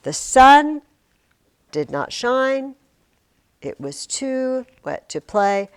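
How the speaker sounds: slow reading, hard beat on "sun", "shine" and "play"; metrical stress. Shown as a demonstration. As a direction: punch "sun" loud, then pace out each phrase. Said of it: "Listen to the beginning of The Cat in the Hat".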